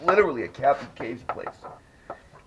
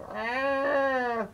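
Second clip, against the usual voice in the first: high thin imitated mewl; cat mewl imitation. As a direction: mimic the little cat cry, high up.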